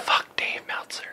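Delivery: whispered curse. Real, not acted. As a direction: hiss it under your breath. Repeats it unvoiced.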